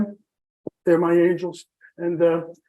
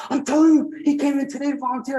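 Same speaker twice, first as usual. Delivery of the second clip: high, emphatic imitation of the nurse; excited insistence; 8 semitones higher, faster than usual. Nurse convincing a disbelieving former patient, excited insistence. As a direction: mimic her insisting, pitch jumping up.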